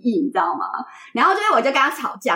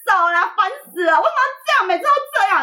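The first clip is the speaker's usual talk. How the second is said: angry yell; 12 semitones higher, faster than usual. Retold or imitated. To her boyfriend pulling her back on the street, a high whiny tantrum. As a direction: throw a tantrum at him.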